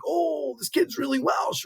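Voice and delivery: high, hushed imitation of impressed onlookers; mock amazement. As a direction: coo in put-on wonder, high and soft. Mimics amazed Welsh onlookers.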